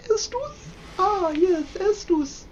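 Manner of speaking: very high little voice; comic falsetto musing; silly falsetto. Real, not acted. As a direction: squeak it out up high.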